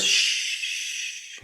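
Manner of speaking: long hushing shh. Acted. Actor silencing the thoughts in their head, long hush. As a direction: shush the racing thoughts.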